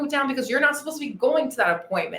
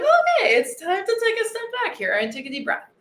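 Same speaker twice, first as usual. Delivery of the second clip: calming myself down; 10 semitones higher, faster than usual. Retold or imitated. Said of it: "I'm like".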